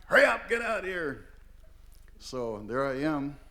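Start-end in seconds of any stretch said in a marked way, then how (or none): 0.1-1.2 high-pitched impatient uncle's call; acted hurry-up shout; imitated insistent yell. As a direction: holler to rush a kid outside. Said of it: "insistent for me to get out".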